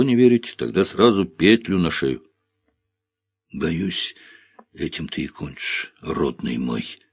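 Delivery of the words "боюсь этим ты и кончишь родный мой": quiet, sorrowful and low; a quiet, mournful foreboding; sad. A narrator's reading. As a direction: grieve softly over him. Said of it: "печально произнесла Александра".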